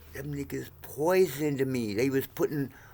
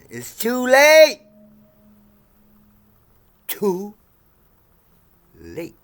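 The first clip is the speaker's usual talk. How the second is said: taunting; 8 semitones higher, 8 dB louder, slower than usual. Real, not acted. Mocking people who call.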